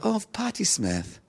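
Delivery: soft, high, drawn-out fond sigh of words; touched. Retold or imitated. Moved by his friend's advice, soft and touched. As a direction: melt softly, slowly over the name.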